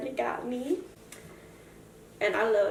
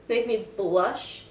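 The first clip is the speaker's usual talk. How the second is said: ordinary delivery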